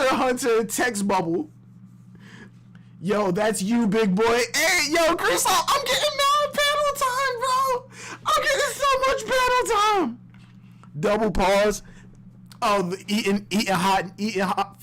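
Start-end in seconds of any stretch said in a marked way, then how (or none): 4.5-10.2 very high, thrilled yelling; excited squeal. Real, not acted. Fan telling Chris about his panel time, squealing high. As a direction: squeal it out with delight.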